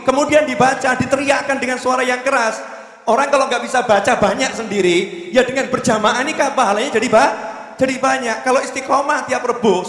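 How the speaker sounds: high, fervent exhortation; preaching at full force. Acted. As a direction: urge the whole hall to join in. Exhorting congregation to chant.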